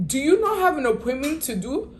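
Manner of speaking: high, incredulous question; exasperated demand. Retold or imitated. Confronting late stylist on phone.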